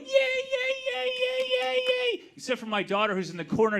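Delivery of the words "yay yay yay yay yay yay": put-on high, excited kids' yay; kids cheering. Party kids greeting Darth Vader, high cheering. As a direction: cheer like a bunch of little kids. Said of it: "All the kids are going".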